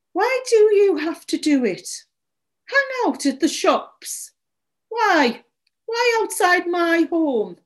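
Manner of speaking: high, exasperated plea; distraught questioning. A narrator's reading. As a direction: demand to know why, upset. Tearful owner berates the boy.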